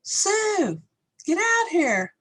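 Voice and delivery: put-on very high little-kid holler; imitated child's yell. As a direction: mimic a small child copying dad's yell. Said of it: "And so I yell".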